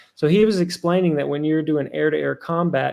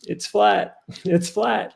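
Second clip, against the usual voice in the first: higher, cheery confirmation with a laugh; bright and cheery; 6 semitones higher, much slower than usual. Retold or imitated. Pilot cheerfully confirms to the flat earther, lightly imitated. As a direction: mimic the pilot's happy little laughing yes. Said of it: "he was bright and cheery".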